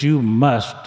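"must" hit hard and loud, retold preacher; stress on must. Preacher to a drunk seeking help, leaning on "must". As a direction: lean on "must" like the preacher did. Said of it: "he emphasized that word must".

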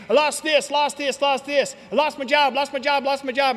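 mock the guys boasting what they lost; bragging meeting guys. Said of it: "you hear these guys saying".

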